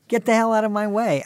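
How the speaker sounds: loud, high-pitched mock order; rude mock shout. Man telling old people to move, mock shouting.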